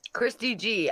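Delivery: ordinary talk, nothing marked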